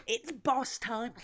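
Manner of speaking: high, drawn-out announcer call; commentator catchphrase. Retold or imitated. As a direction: blurt it slowly like a compulsive catchphrase. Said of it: "It's like a disease".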